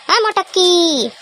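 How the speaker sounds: sing-song summons; drawn-out call. Acted. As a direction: stretch the name to wake her. Calling to wake sleeper.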